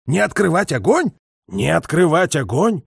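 stunned query to a superior; incredulous questioning. A narrator's reading. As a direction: repeat the order in disbelief, slowing down. Incredulous at order on the phone.

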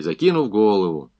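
ordinary delivery

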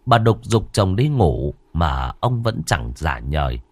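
usual speaking voice

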